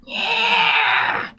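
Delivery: high, shrill-pitched imp screech flying off. Retold or imitated. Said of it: "just screeching up".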